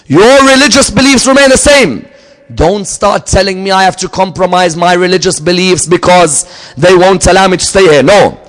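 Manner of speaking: high, loud preacher's build; impassioned sermon climax; full-force preaching. Acted. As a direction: thunder it out to the congregation. Preacher pressing the point.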